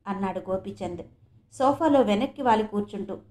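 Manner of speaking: usual speaking voice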